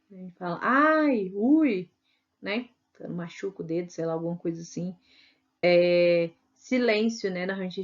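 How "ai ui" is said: mock "ai, ui" said louder; lightly acted ouch; pain cries. Shown as a demonstration. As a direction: yelp like you stubbed a finger.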